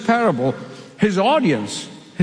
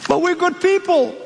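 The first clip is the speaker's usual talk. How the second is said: higher, pleading protest; a higher, whiny plea; pleading foolish ones; 8 semitones higher than usual. Acted. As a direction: beg to be let in.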